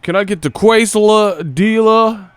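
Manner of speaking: high, loud, slow goofy voice; mock clueless customer. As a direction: ham up the dude ordering a quesadilla. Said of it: "he was like".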